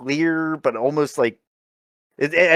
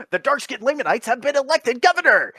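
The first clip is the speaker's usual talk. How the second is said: mock announcement; 10 semitones higher than usual. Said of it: "he's like".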